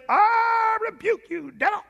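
hammed-up slow shouted shriek; mock shriek. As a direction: shriek it out in a quivering preacher voice. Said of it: "He didn't say".

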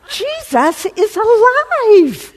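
slow, high, loud joyful cry with laughter; ecstatic shout. Retold or imitated. Friend calling at work with news, joyful shout. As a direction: shout the news like you can't contain it.

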